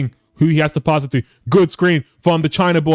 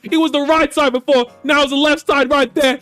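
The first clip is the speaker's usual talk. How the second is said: live play-by-play; 7 semitones higher than usual. Acted.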